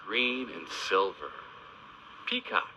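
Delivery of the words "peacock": high sing-song. Shown as a demonstration. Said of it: "repeats word high".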